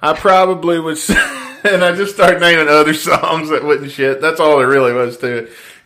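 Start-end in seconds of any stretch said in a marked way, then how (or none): none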